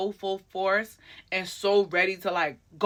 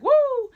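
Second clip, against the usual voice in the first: high, loud "woo"; crowd woo; 7 dB louder than usual. Retold or imitated. Fans cheering from the crowd, imitated high whoop. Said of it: "There's a few woos I'm hearing".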